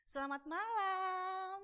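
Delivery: high, very slow "Selamat malam"; drawn-out sing-song good evening; forced cheery greeting. Retold or imitated. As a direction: sing out "malam" on a long held note. Forced greeting to the avoided person.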